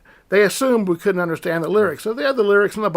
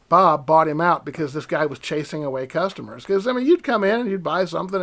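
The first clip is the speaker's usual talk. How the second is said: ordinary talk, nothing marked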